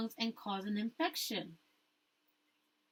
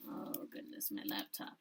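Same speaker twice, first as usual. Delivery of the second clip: whispered aside; 12 dB quieter than usual. Real, not acted. Teacher's aside about her laptop.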